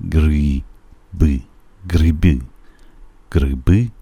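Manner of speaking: gri-b spoken clearly; Russian word. Shown as a demonstration. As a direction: zeg 'گریب' langzaam met een duidelijke g en korte i.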